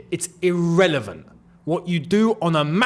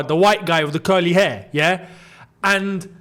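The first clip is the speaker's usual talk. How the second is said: ordinary talk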